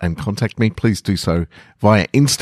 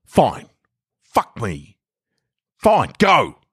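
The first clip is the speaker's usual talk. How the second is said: higher, annoyed surrender; exasperated giving in; 5 semitones higher, slower than usual. Acted. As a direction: cave in with irritation. Passenger giving in angrily.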